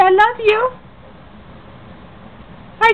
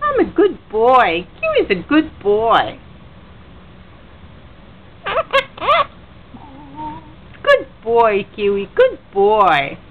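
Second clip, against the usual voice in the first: deep voice; 6 semitones lower than usual. Real, not acted. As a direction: rumble it from the chest. Deep praise to pet bird.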